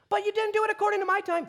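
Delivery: fast, high, shouted objection; mock protest. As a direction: argue back loudly and quickly. Acted argument with God.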